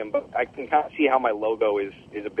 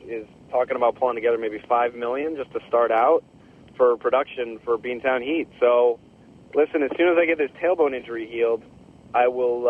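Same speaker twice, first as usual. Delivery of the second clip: ordinary delivery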